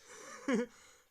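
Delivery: loud amused laugh; a real loud laugh at the title; burst of laughter. Real, not acted.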